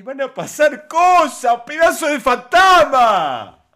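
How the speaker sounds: loud, slowed mocking bellow of the threat; hammed-up angry yell. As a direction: shout it back at him like a parody of his tantrum. Reactor mocks the man's threat.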